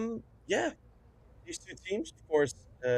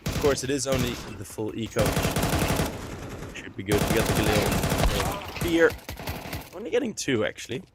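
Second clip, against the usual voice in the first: lively esports casting, slightly louder; play-by-play; 4 dB louder than usual. Acted. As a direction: call the live action with energy. Casting live esports match.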